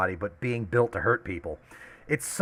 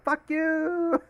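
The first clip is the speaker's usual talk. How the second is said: mock taunt. Mock taunt while fleeing.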